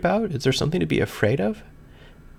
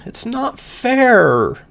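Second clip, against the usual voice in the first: slow, louder, higher, near tears; voice breaking; 10 semitones higher, 6 dB louder, much slower than usual. A narrator's reading. Overwhelmed narrator pleading alone in her room, voice breaking. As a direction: let the words crack as it overwhelms you.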